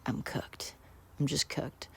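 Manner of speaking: breathless whisper of defeat, nearly no voice; resigned whisper. Retold or imitated. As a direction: whisper it like you know it's over. Realizing he is caught.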